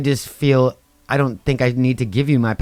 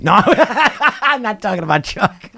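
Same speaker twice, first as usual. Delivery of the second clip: high, slow laughing apology; 10 semitones higher, slower than usual. Real, not acted. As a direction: laugh through the words. Embarrassed slip about Chuck.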